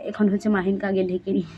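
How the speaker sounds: ordinary talk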